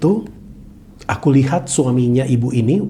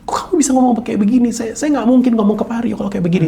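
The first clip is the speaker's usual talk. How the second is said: high, fast, disbelieving; alarmed protest; 9 semitones higher, faster than usual. Retold or imitated. Pastor's wife protesting to her husband, alarmed. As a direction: push back in shocked disbelief.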